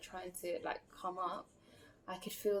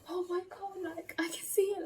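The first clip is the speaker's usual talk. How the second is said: high, breathless whisper of wonder; imitating her boyfriend's hushed amazement; awestruck boyfriend; 12 semitones higher, 5 dB louder than usual. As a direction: whisper it high like he can't believe it.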